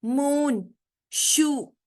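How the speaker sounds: मून and शू with "oo" held long. Shown as a demonstration. Teacher shows students long vowel sounds, मून, शू drawn out. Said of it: "long निकल रही है".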